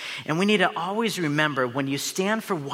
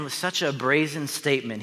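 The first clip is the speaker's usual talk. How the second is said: ordinary talk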